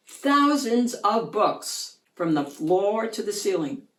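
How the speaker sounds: usual speaking voice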